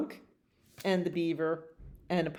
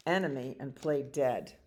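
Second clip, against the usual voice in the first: ordinary delivery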